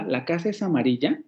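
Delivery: "La casa es amarilla" asked, rising on the end of "amarilla"; yes-no question with the final weight on "amarilla"; question intonation. Shown as a demonstration. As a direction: ask it, lifting the tail of "amarilla" sharply. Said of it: "cuando yo hago una pregunta".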